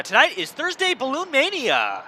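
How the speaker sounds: big announcer hype; infomercial voice. Acted. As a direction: sell it like a TV infomercial. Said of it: "I'm using the infomercial voice this evening".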